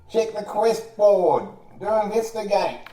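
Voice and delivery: high, loud yell through a door; yelling back. Acted. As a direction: holler instructions slowly at a stranger outside. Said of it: "And he yells back".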